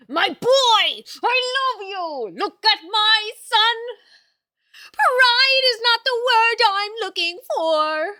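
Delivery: high, loud, slow, overjoyed praise; gushing proud shout. Real, not acted. Streamer gushing loudly over her dog in her lap. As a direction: squeal with pride over the dog, near tears.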